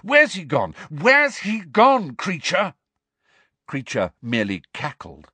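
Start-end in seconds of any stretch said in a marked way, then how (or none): 0.0-2.7 high, loud, insistent questioning; urgent demand. Acted. Harry demands answers from the elf, urgently. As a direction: demand it, slower and louder on the repeat.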